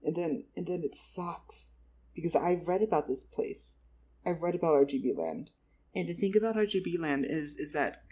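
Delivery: ordinary talk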